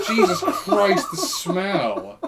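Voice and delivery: loud, slow, revolted groan; disgusted. Pretend. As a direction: recoil from the stench. Reacting to mock smell.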